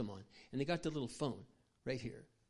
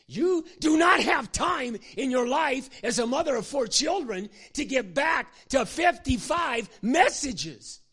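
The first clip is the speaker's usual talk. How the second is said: much higher and louder, slow and hammered out; full-force pulpit shout; preaching shout; 11 semitones higher, 14 dB louder, slower than usual. Real, not acted. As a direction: thunder it at the mothers in the pews.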